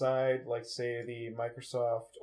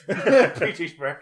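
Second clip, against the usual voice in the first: loud, slow, higher echo with laughter; mock announcement; 5 semitones higher, 13 dB louder, much slower than usual. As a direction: parrot the silly announcement, drawn out.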